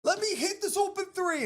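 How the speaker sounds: very high-pitched mock frustration; frustrated. Malik Monk begging for the open shot, frustrated. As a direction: whine it high, imitating a frustrated player. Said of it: "He's frustrated".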